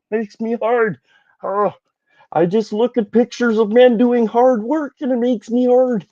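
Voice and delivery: high mocking falsetto parody; Jordan Peterson voice. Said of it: "when he starts talking about".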